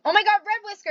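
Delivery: high, loud screech of the name; screech of joy. A narrator's reading. Cat screeches running to her lost friend. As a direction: screech seeing your friend back. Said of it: "Enderhowl screeched".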